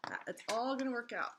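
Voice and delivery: ordinary delivery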